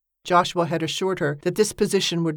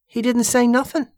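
higher, louder voice of a simple working man; simple Mattie; 7 semitones higher, 4 dB louder than usual. Acted. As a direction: play the innocent, offhand. Mattie's voice in dialogue.

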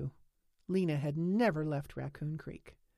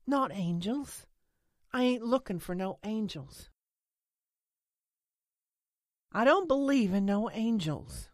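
higher, mildly heated denial; angry denial; 5 semitones higher, 4 dB louder than usual. A narrator's reading. Lena walking away from Holly's question, angry. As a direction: reject the idea with growing force. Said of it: "Lena walked angrily away".

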